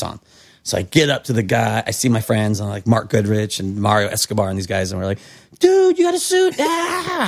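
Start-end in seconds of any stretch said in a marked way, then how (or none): 5.6-7.2 much higher, stoked surfer shout of joy; hyped-up buddies. Retold or imitated. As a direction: cheer like teen friends seeing the new gear. Friends greeting the new wetsuit.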